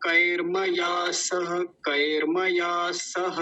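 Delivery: "कैर्मया सह" slow, half r before ma; words joined. Shown as a demonstration. Teacher adds the next word, slow linked demonstration. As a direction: sound out "कैर्मया सह" with saha fully voiced. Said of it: "और अगला शब्द है सह".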